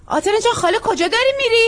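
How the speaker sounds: put-on high, loud call after a child. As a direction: stop her from afar with your voice. Calling after the girl.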